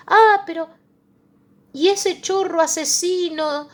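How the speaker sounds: high, loud indignant protest; outraged objector. Acted. A preacher voicing the congregation's outrage, high indignant cry.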